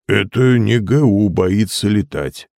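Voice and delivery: slow echo in another character's voice; obedient repetition. A narrator's reading. John repeating after the hypnotist, slow echo. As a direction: echo the line slowly, as if entranced.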